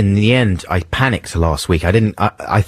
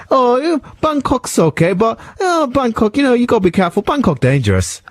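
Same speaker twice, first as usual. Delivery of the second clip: high, put-on Thai accent; mock Thai accent, pitched high. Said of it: "accent I did there as well".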